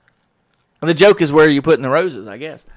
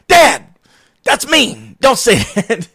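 mock scolding daughter; 9 semitones higher, 6 dB louder, much slower than usual. Daughter scolding dad in car.

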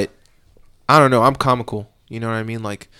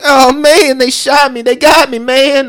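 high, loud hammed-up wail of "they shot me"; mocking a suspect crying he's been shot; whiny shot suspect; 15 dB louder, slower than usual. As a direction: play the overdone victim, whining loud and high. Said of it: "And he was like".

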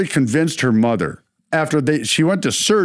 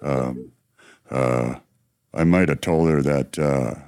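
low, soft, halting guilty mumble; a low, hushed mumble of a caught kid; mock sheepish confession; 9 semitones lower, 4 dB quieter than usual. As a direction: sheepishly own up between nervous huhs. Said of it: "And she's like".

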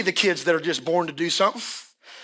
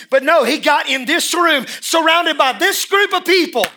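louder and higher, building to a shout; rising sermon intensity; 10 semitones higher, 7 dB louder, slower than usual. Real, not acted. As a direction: drive it home to the crowd. Preaching building again.

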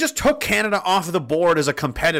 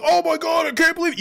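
much higher, put-on gasping disbelief; mock the upset people in a squeaky voice; mock shocked whiner; 12 semitones higher than usual. Mocking upset people, high shocked whine. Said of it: "Some people are still getting really upset".

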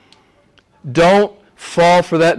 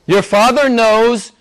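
louder, higher and slower, proclaiming; ringing declaration; 8 semitones higher, 5 dB louder, slower than usual. Acted. Preacher to congregation, a ringing proclamation. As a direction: lift it up as a proclamation. Climactic line in sermon.

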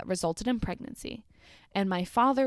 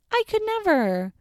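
squeaky high disbelief; high squeal; 12 semitones higher, 6 dB louder than usual. Real, not acted. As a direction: squeal it out in shock. Repeats the reaction, squealing.

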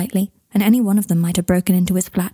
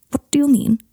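sharp, high-pitched question; suddenly sharp; 7 semitones higher than usual. A narrator's reading. Malfoy questioning Harry's confession, suddenly sharp. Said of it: "his voice suddenly sharp".

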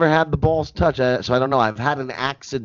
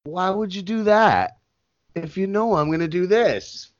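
mock hurt whine; 5 semitones higher than usual. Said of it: "When I looked at you like this".

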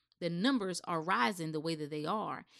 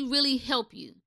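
usual speaking voice

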